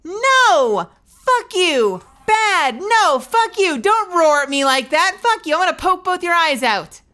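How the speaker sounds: high, loud hammed-up shouting; mock furious yelling. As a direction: scream at the pet in play rage. Yelling at the roaring pet.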